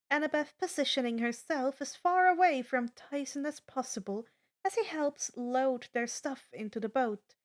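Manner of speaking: ordinary delivery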